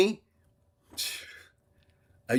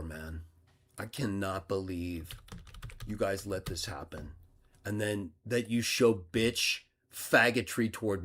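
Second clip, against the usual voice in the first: low, much softer, fast aside; disgusted mutter; 6 semitones lower, 11 dB quieter than usual. Real, not acted. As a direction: grumble it half to yourself. Muttering in disbelief.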